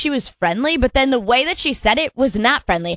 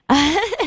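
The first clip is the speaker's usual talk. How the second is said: short laugh; 7 semitones higher than usual. Real, not acted. Laughs at own plan.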